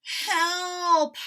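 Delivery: bleary plea in the sun; startled Toad. Acted. Toad cries out.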